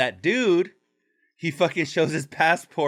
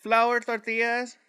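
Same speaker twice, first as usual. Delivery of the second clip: vendor call; 10 semitones higher than usual. Retold or imitated. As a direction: stretch it out like a hawker. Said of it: "or, like, you know".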